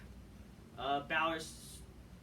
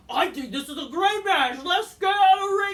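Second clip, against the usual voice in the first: much higher, much louder shrieky mock voice; mocking Abby; 11 semitones higher, 13 dB louder than usual. Vlogger parodying Abby's promo, high and shouting. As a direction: screech it like a cartoon villain. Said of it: "Abby's like".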